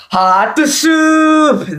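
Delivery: drawn-out high exclamation. Pretend. Episode title performed.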